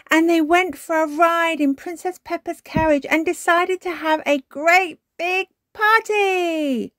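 put-on high, loud child's story, stretched at the end; excited cry. A narrator's reading. Peppa dragging out the bedtime story, excitedly crying out. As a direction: gush on, building to a big finish. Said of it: "she cried".

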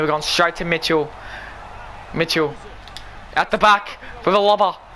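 ordinary talk

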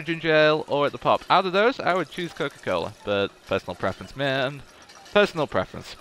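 ordinary talk

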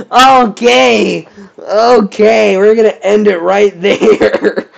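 high and loud through laughter; laughing. Real, not acted. Ending the video, still laughing hard. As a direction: wrap up while still cracking up.

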